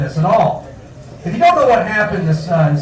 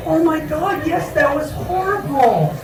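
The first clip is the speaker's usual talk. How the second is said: mock mom; 7 semitones higher, 5 dB quieter than usual. Mom reacting on the phone.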